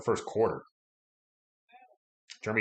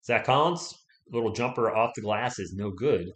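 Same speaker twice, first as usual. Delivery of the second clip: ordinary talk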